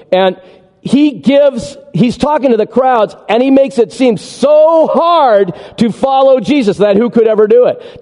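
ordinary delivery

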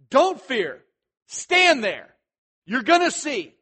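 forceful leader taking charge; yelling orders. Acted. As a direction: shout each command at the frightened crowd. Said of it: "he's yelling at them".

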